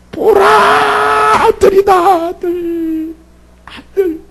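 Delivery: high tearful shout of joy; shouting with joy, voice breaking; joyful cry. Acted. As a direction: cry out with joy at a newborn son. Leah cries out at son's birth.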